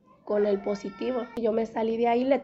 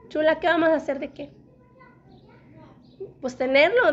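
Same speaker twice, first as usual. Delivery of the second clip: quick, raised, a little exasperated; mock-annoyed husband; 5 semitones higher, 5 dB louder than usual. Husband cutting off his nagging wife, mock-annoyed and fast. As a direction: imitate the husband snapping back, half-serious. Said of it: "mi esposo como que se enfadó".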